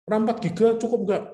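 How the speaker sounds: high-pitched, eager customer question; imitated shopper's query, pitched well up; customer asking. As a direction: play a customer checking with the shopkeeper. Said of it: "misalkan ada orang tanya".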